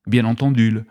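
normal talking voice

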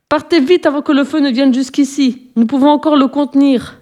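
high, urgent firemen's call; urgent cry. Acted. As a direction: shout the warning to the animals. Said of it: "s'écrièrent-ils".

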